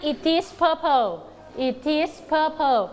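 put-on slow, clear reading for kids to copy; slow model reading. Shown as a demonstration. Teacher praising kids, then modelling the sentence slowly. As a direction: spell it out slowly for the class. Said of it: "เด็กๆ เก่งมาก".

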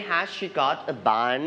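"Has she got a bun?" with the voice rising on bun; rising tune on question. Shown as a demonstration. Teacher models a yes-no question for the class, rising at the end. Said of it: "you need to put rising sounds".

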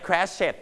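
"crashed" said as "crash-et"; two syllables, "crashed" ending in "-et"; wrong extra syllable. Shown as a demonstration. Teacher rules out the mistake, "crashed" as "crash-et". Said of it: "ไม่ crashed นะ ไม่มี".

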